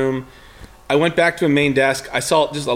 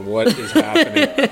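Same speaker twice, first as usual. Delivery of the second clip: higher, drawn-out alarmed question; alarmed disbelief; 6 semitones higher, slower than usual. Retold or imitated. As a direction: retell a jolt of alarm, slowly. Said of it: "my heart skips a beat".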